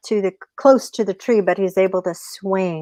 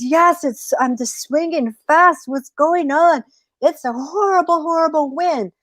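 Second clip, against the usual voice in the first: high, anxious storybook coyote; alarmed coyote; 7 semitones higher than usual. Acted. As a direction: cry out while swinging in a storm.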